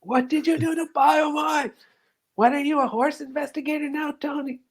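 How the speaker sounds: a high silly impression of a sneering man; squeaky mock Ralph. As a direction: squeak it out mockingly. Voicing Ralph again.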